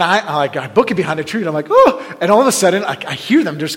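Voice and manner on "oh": short, high, loud cry; alarmed whoop. Retold or imitated. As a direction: yelp it as you duck for cover. Acts out alarm behind tree.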